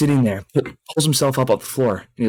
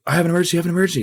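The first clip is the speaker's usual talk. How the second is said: high-pitched mock emergency report; breathless messenger's alarm, lightly acted; urgent alarm; 8 semitones higher, much faster than usual. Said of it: "a monk comes running into the mess hall".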